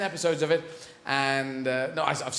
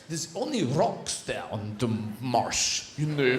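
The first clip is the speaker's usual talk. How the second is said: put-on slow, low comic Russian accent; 5 semitones lower, slower than usual. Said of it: "But with a Russian accent".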